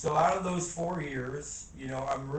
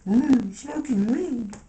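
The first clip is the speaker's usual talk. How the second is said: high, loud, slow scandalized mock voice; mocking old-timers; 9 semitones higher, 9 dB louder, much slower than usual. Mocking how people judged weed thirty years ago. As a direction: drag it out like a shocked prude. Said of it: "people were like".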